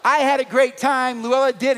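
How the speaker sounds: rising to a loud, high shout. Real, not acted. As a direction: belt it out to the crowd. Preacher building to a shout.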